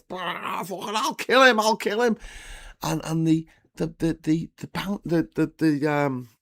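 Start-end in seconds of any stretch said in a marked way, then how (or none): 0.1-2.2 high, frantic Scouser threats; high-pitched acted rage while brawling; enraged attacker. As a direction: lay into him in mock fury. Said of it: "the Scouser's laying into him, like, proper".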